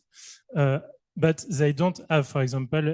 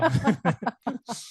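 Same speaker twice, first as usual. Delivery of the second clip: a short, high embarrassed giggle; embarrassed laugh; 9 semitones higher than usual. Real, not acted. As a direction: laugh it off, a bit sheepish. Embarrassed after forgetting question.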